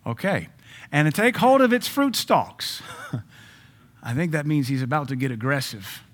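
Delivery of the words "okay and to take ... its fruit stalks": mock eager husband. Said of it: "this is the husband obviously talking".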